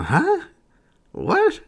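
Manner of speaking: high rising squeak of disbelief; exaggerated high-pitched bewilderment; baffled reaction. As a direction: blurt it with pitch shooting upward. Acts out baffled viewer reaction.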